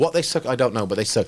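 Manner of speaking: ordinary talk, nothing marked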